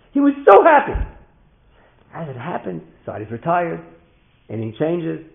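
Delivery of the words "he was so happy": very high, loud cry on SO HAPPY; overjoyed emphasis. A narrator's reading. Father relives his son's joy, overjoyed and loud. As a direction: belt out the joy. Storyteller repeats line, much bigger.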